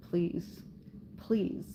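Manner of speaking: soft, worn-out plea for help; hushed, helpless acceptance of help; quiet plea. Retold or imitated. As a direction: retell a small, quiet "please". Retelling her exhausted plea for help.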